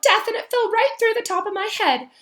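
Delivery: put-on high, loud wailing girl ghost; miserable ghost girl. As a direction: sulk and wail like a self-pitying ghost. Myrtle voice held.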